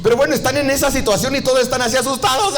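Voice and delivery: ordinary talk, nothing marked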